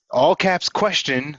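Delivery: slow, loud, each word punched: all caps question; all caps stressed. Shown as a demonstration. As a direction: spell out the format slowly and firmly. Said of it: "So I'm gonna model it real quick".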